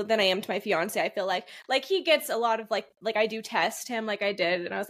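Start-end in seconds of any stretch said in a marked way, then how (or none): none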